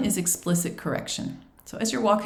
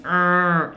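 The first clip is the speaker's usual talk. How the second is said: loud held "arr" growl; demonstrated teeth-clenched growl; angry growl; 6 dB louder than usual. Shown as a demonstration. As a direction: growl it to show there is no H. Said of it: "you can hear the difference".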